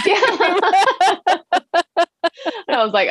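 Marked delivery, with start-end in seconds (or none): 0.1-2.1 bright real laugh over a yeah. Real, not acted. Guest laughing at her failed first batch. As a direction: laugh it off, caught by the joke.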